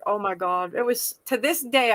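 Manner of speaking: ordinary talk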